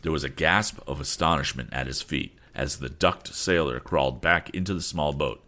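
plain reading voice

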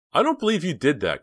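high, loud cry of disbelief; shocked outcry, pitched well up; cried out. A narrator's reading. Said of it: "cried Dina".

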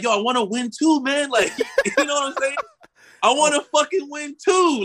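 high, laughing plea to win too; pleading laugh. Real, not acted. Telling a friend he wanted to win too, laughing plea.

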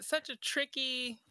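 normal talking voice

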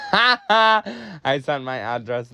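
laughing through the line; can't keep a straight face reading it; giggling while admitting it. Real, not acted. Laughing while reading own reply.

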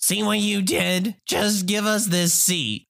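high, slow scolding Karen voice; entitled mother. Acted. Entitled mother blames traveler and demands seat, high and scolding. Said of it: "Entitled mother".

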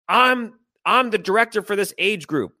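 high, mildly mocking outburst; retold screaming. Parent announces his title to coaches, mock scream. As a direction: imitate an irate parent pulling rank. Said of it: "He comes screaming".

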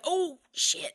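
high, sudden "oops" voice; mock dismay. Heroes realising they shot the bosun, mock dismay. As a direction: blurt it like caught out. Heroes after shooting Jackson.

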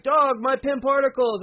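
high, loud mock shout of alarm; alarmed yell. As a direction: cry out in hammed-up panic. Hawkeye yells in alarm.

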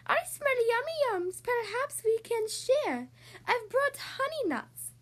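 high, sweet badger voice, slow and coaxing; a friendly badger with a grin offering treats; grinning badger. Badger offering honey nuts to the friends, grinning high voice. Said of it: "Badger says with a grin".

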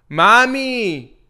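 high, drawn-out calling to a partner; sweet-talk call. Acted. Boyfriend cooking arepas calls his partner from the kitchen, sweet-talk call. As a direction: call out in a mock-loving singsong.

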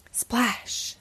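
breathy whispered sound word; whispered splash. A narrator's reading. Narrator marking the fall into the pond, whispered.

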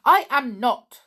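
loud, high protest; stung and shouting back; indignant denial. Acted. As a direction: deny it hotly. Angry denial in quarrel.

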